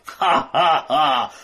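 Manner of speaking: slow mocking villain laugh; evil laugh. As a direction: cackle down at the heroes.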